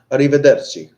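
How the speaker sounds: Italian accent, arrivederci rolled out loud and high; hammed-up Italian accent on arrivederci. Shown as a demonstration.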